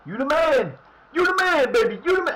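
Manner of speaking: very high, loud cheering chant; hype shout. Real, not acted. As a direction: pump the player up. Hyping the new player, building.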